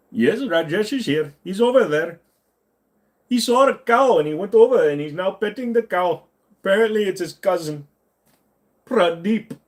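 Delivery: put-on sing-song Indian accent, chatty and cheerful. Streamer acting out an Indian neighbour for chat, cheerful Indian accent.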